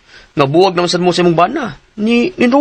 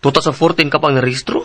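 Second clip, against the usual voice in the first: ordinary delivery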